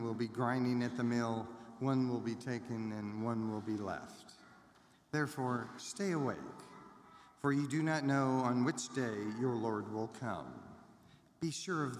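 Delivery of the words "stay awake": ordinary delivery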